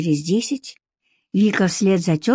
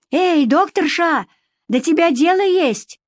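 calling out; 9 semitones higher than usual. Acted. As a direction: hail someone from a distance. Village woman hails Vika across distance.

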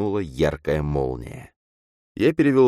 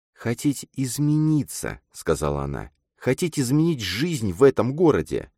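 put-on earnest young woman character; 8 semitones higher than usual. A narrator's reading. Girl urging her friend to want change, earnest.

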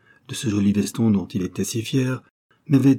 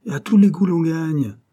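high, louder mock barker call on "tous les coups"; barker's cry; 7 semitones higher, 4 dB louder than usual. Boy imitating the game owner shouting his slogan, mock barker call. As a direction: sell "Et à tous les coups l'on gagne" like a stall keeper. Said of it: "rien qu'à crier".

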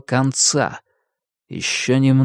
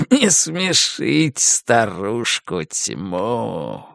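menacing darkness voice; 2 semitones lower, much slower than usual. Acted. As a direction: gloat slowly as ancient evil. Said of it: "голос продолжал говорить".

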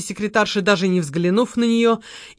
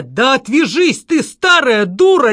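громко и грубо, медленно; оборвать её зло и громко; рявкнуть; 4 semitones higher, 5 dB louder, slower than usual. A narrator's reading. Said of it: "рявкнул Володарский". (грубый громкий рык)

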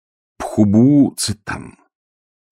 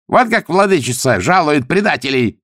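high, raised, mocking exclamation; gloating; 9 semitones higher, 4 dB louder than usual. A narrator's reading. Pkhubu Tsitan displays the severed head, gloating. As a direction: crow over the traitor's fate. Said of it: "захохотал Пхубу Цитан".

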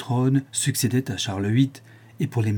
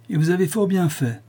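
higher, clipped stern reply; curt and hard; 8 semitones higher than usual. A narrator's reading. Father answers son's protest, curt hard tone. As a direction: cut him off coldly. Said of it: "d'un ton sec et dur".

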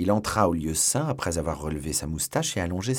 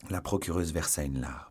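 plain reading voice

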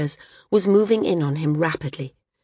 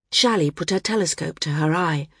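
ordinary delivery

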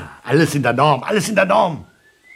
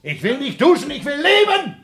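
panicked cry. Acted. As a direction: cry out in fear of death. Panicked reply to friend. (panisch, verzweifelt schreien)

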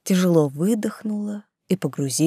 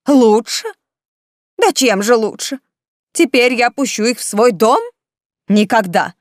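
angry refusal; 6 semitones higher, 5 dB louder than usual. Acted. Aunt rejects niece's plea.

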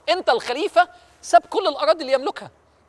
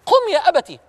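higher and louder, pressing his father; the son insisting more strongly now; urgent plea; 6 semitones higher, 3 dB louder than usual. Acted. Son urges his father twice. (إلحاح بصوت أعلى)

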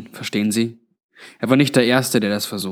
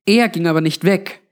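higher-pitched insistent Granny; lightly voiced Granny, interjecting; 6 semitones higher than usual. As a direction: cut in with a higher voice. (leicht gespielte Granny, mit höherer Stimme einwerfend)

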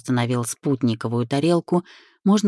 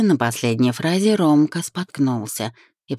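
usual speaking voice

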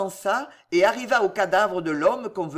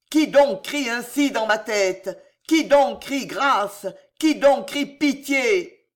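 roaring; 2 semitones higher, 3 dB louder than usual. Acted. Charles roars for the bells to drown the cries, raging. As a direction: roar at the bells to drown the screams. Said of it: "Et il rugit".

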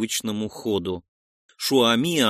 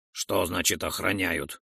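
low, softer wary character voice; frowning question; 6 semitones lower, 4 dB quieter than usual. A narrator's reading. Roldon asks the hero about the guards, frowning question. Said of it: "нахмурился Ролдон".

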